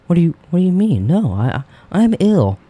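mock feigned innocence.

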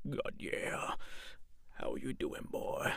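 very soft, husky mock audition voice; opposite-to-looks voice. Actor joking about auditioning against a character's looks, hushed opposite voice. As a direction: drop it to a low, hushed murmur. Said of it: "complete opposite voice to how they should look".

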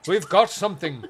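a mock Irish detective reporting in; bad Irish accent. Podcaster doing a bad Irish accent as a detective. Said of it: "I'm gonna do bad Irish accents".

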